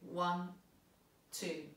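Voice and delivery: counting slowly in two. Shown as a demonstration. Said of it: "a slow two".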